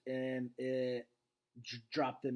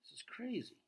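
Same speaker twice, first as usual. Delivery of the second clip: George, disbelieving; 9 semitones higher, 8 dB quieter than usual. Acted. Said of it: "George says".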